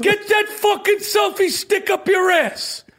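high, slow furious tough-guy insult; Danny Aiello impression. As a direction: spit it out like Sal from the pizzeria. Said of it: "That's Danny Aiello".

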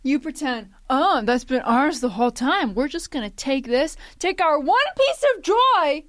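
high, loud indignant rant; mock outrage. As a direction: protest like a wronged fan.

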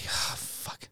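breathy muttered "oh, fuck"; under-the-breath dismay; whispered curse. Retold or imitated. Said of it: "I'm like".

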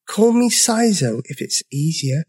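high little dinosaur voice; small, high-pitched friendly offer; tiny voice. A narrator's reading. Dinosaur offering his nickname, tiny voice. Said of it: "in a voice so tiny".

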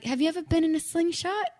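high-pitched, badly faked Australian accent; attempted Australian accent. Singer quoting tourmate about the gift, attempted Australian accent. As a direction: have a shaky go at an Australian accent. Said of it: "I can't do an Australian accent".

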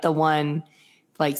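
ordinary talk

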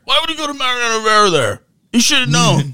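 high, fast sneering whine of a second-guessing fan; mocking critic. Co-host mocks fans blaming the manager with a high, fast sneer.

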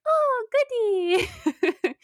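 very high, slow cooing with a laugh; cooing at her dog. Retold or imitated. Podcaster shows how she greets her own dog, very high and slow. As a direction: croon the name like the boy in the game. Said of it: "I'm like".

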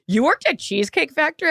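high, amazed question; friend's husband reading the bio, high and amazed; surprised husband. Retold or imitated. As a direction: act out the friend's husband in disbelief. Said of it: "And Jarrett goes".

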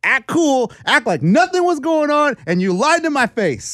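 mock scolding. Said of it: "he reams in there".

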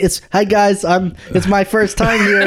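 awkward nervous guest. Retold or imitated. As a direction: imitate a nervous first-time guest. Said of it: "how awkward he was".

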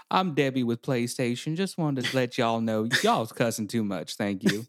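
a hint of a Texan Southern accent, operator politeness. Joking about a Southern PlayStation operator warning players, light Texan Southern accent. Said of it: "Texan Southern accent recorded call".

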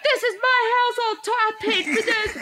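high-pitched mock indignation; imitated husband ranting. Husband insists he paid for house, mocking high-pitched rant. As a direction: parody an outraged homeowner. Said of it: "he's like".

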